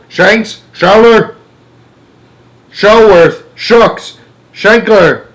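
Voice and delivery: high, loud shouted names; frantic calling. A narrator's reading. President calling into the dead radio, crying out. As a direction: holler for anyone to answer. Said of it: "cried the president".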